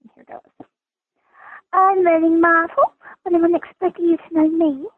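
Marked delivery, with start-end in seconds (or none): 1.7-4.9 high, self-pitying whine building into a wail; Moaning Myrtle. Acted. Introducing poor, miserable Myrtle, high moaning ghost voice. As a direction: whine miserably, then let it swell into a piercing moan. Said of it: "I'm doing the impression of Moaning Myrtle".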